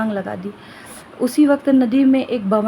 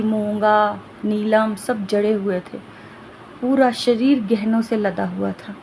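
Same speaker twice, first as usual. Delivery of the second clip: usual speaking voice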